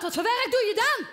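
a mock insistent shout at the man. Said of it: "ik vraag wat".

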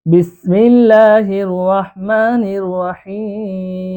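slow, drawn-out Quranic recitation. Shown as a demonstration. Teacher opening the class with a slow recited bismillah. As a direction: intone it slowly and reverently. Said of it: "opens the Quran class".

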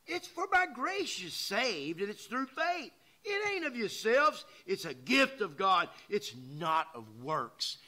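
loud, high, slow pulpit reading of scripture; preaching scripture. Acted. Preacher reading Ephesians to the congregation, loud proclaiming. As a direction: proclaim the verse to the church.

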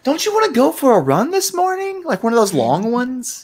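high sugary mock wife voice; mock sweet wife. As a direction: coax him out the door, sugary sweet. Mocking wife's suggestion to husband.

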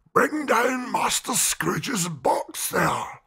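loud, slow bellow; terrible shout. Acted. Schoolmaster calling for the boy's box, slow loud bellow. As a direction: boom it down the hall. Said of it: "A terrible voice in the hall cried".

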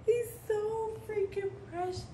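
high, soft voice cracking with tears; tearful. Real, not acted. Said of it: "I find myself crying all the time".

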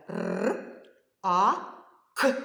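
«Р, А, К» as three separate sounds. Shown as a demonstration. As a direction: sound out «Р», loud «А», short «К», with gaps. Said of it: "давайте назвемо послідовно звуки". (окремо за звуками: «Р», «А», «К»)

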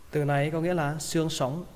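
ordinary delivery

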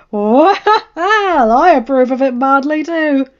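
lightly voiced John, playful; amused approval. A narrator's reading. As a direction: lean into it with amusement. John laughing, teasing approval.

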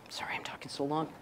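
soft whispered apology; whispered aside. Real, not acted. Speaker apologizing to the host for running long, whispered.

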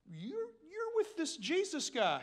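lightly acted accuser, high and slow; accusing bystander. Someone recognizes Peter in the shadows, lightly acted accusation.

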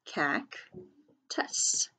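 "Cactus" split as Cac, long pause, tus; slow, broken-up "Cactus" for early readers; syllable by syllable. Shown as a demonstration. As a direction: sound out "Cactus" in two separate syllables. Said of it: "sound it out by syllables".

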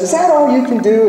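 high-pitched parrot squawking out a taunt. Retold or imitated. Parrot heckles the plumber at work, high parrot voice. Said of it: "Parrot says".

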